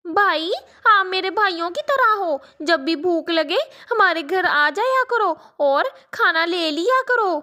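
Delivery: put-on a girl welcoming a hungry boy; kind little girl. Girl speaks to crow child.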